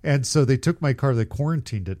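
ordinary talk

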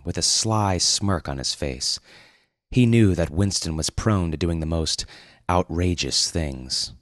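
ordinary delivery, nothing marked

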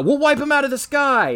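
high-pitched declaration in imitation; hammed-up high, confident vow; mock tough threat. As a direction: announce it like a cheesy action hero. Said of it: "he says".

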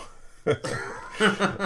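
quick, high, airy laugh; breathy laugh. Real, not acted. Host laughing at his own slip, high airy laugh. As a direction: laugh it off at yourself.

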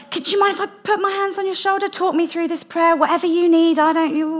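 high-pitched, hesitant fussing; mock awkward vicar. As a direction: imitate a nervous vicar in a high voice. Retold awkward vicar offering prayer.

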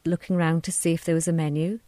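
ordinary delivery, nothing marked

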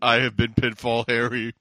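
low, loud mock reveal; hammed-up solemn villain; ominous villain voice. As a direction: declare it deep and grandly. Hammy riff in Peter's voice.

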